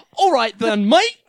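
loud, hammed-up Australian bloke; mock Aussie accent, breaking into laughter. Teasing as a boyfriend asked for an accent, mock Australian. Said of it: "Mine was Australian".

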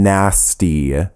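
"nasty" drawn out slowly; slow, heavily underlined "nasty"; stress on nasty. Real, not acted. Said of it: "I underline the word nasty".

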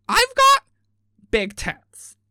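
"got" squealed high and loud, then drops; mock bimbo repeat. Repeats louder after "What?.

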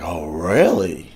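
low, slow, menacing disbelief; retold incredulous comeback. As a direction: drop low and draw it out. Sarcastic comeback to yelling driver.

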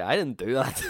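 mock protest. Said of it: "and I was like".

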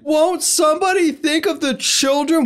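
high, slow, wailing plea; panicked church lady. Retold or imitated. Quoting The Simpsons church lady in a high wail. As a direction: beg shrilly like a fretting church lady. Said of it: "That's a reference to something".

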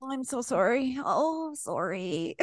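apologetic Canadian. Retold or imitated. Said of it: "making fun of us 'cause we apologized".

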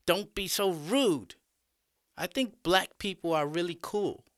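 put-on high-pitched girl's chiding; young female student. A narrator's reading. Arlene turns to defend the teacher to the class, high-pitched. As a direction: chide classmates in a high, girlish voice.

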